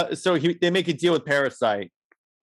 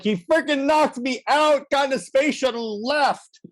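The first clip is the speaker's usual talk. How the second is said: higher, complaining superhero voice; whiny Flash; 8 semitones higher than usual. Retold or imitated. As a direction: play Flash griping that he got knocked out. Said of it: "Flash is like".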